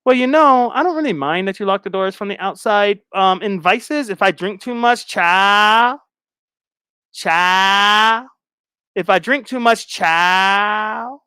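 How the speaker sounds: chatty mock impression, drawn-out last word; Brooke. Guest rambling about her vices, chatty mocking impression. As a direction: ramble on brightly, stretching the final word upward. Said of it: "Brooke ass love to talk".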